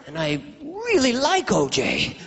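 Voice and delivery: slow, high plea on "really like"; hammed-up anguished hesitation; pained reluctance. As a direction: stretch it out, torn over the scheme. Acted cop torn over framing.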